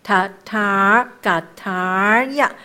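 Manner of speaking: slow 塔塔嘎塔呀, the held 塔 turning into 呀; turning sound. Shown as a demonstration. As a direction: hold 塔, then glide over into 呀. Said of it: "在这个地方就有音就转了".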